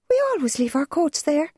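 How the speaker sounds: put-on high, hurt girl's voice; stung protest. A narrator's reading. Girl protests to her mother, high and hurt. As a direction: protest the unfairness like a wronged child.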